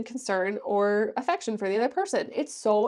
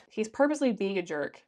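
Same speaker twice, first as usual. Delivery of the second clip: ordinary talk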